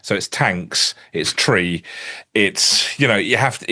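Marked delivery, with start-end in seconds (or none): 0.0-1.8 "tanks" and "tree" with a hard T; Irish T for TH. Shown as a demonstration. Co-host coaching Irish sounds, hard T in "tanks" and "tree". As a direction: say "tanks", "tree", low and measured, T replacing TH. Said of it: "there's no th, it's t".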